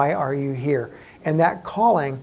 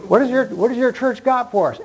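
higher, louder mock questioner; put-on higher voice of a prospective member; imagined newcomer asking; 9 semitones higher, 5 dB louder than usual. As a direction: ask brightly as an outsider sizing up a church. Voicing a prospective church member's question.

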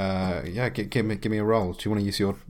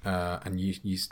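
normal talking voice

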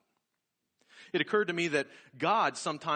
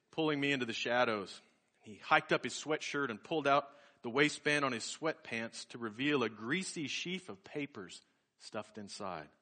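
ordinary delivery

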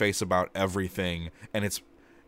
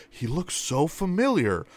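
lightly voiced clueless hero, higher; dopey game hero; 8 semitones higher than usual. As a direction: play a dense protagonist who doesn't get it. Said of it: "my character was like".

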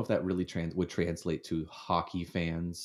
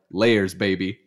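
smug dad boast; 9 dB louder, slower than usual. Retold or imitated. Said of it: "And I'm just like".

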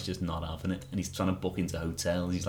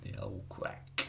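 mock growl; 12 semitones lower, 12 dB quieter, much slower than usual. Jokey gruff aside.

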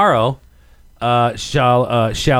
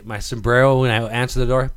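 ordinary talk

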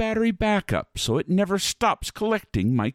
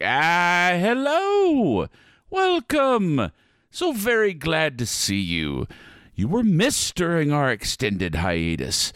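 spooky ride narrator; 3 semitones higher than usual. Acted. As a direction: welcome the guests with flourish. Host greets riders.

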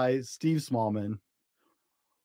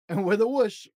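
usual speaking voice

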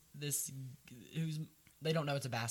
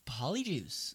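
normal talking voice

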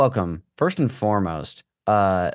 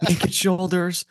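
higher, drawn-out eager client voice; mock eager client; 9 semitones higher, slower than usual. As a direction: gush like a picky client. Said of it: "They're like".

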